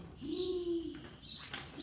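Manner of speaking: sharp suspense gasp; dramatic gasp. A narrator's reading. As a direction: gasp to build tension.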